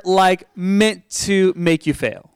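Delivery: ordinary delivery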